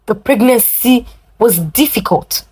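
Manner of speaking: ordinary delivery, nothing marked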